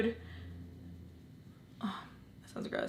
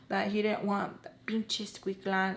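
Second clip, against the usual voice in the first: plain reading voice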